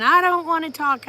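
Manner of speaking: high, loud mock refusal; imitated screaming. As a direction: retell the niece yelling her refusal. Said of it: "Lacey went to screaming".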